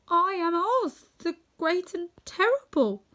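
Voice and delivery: high, slow, frightened little man; trembling voice. A narrator's reading. Little man owns up to attackers, high and trembling. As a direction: falter through the title in a high voice. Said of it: "in a trembling voice".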